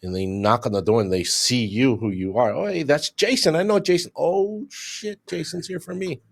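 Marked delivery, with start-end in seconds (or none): 2.5-4.1 high-pitched startled recognition; mock recognition. Suspect opening the door to the detective, high startled recognition. 4.2-6.2 high, then dropping soft with dread; mock dread. Caught suspect realizing who's at the door, high then hushed dread.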